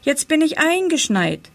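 high, delighted announcement; cheerful exclamation. A narrator's reading. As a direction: announce it with happy relish. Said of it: "rief er fröhlich". (fröhlich und hoch gerufen)

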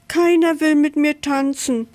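high-pitched, mildly mournful complaint; sighing. A narrator's reading. Camel complaining to the elephant, sighing. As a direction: lament it with a touch of a sigh. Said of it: "seufzte das Trampeltier".

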